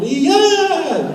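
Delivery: high, loud laughing chant; players' whoop. Retold or imitated. Players copying the coach, loud laughing whoop. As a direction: yell it back, clumsy and laughing. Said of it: "And we're going".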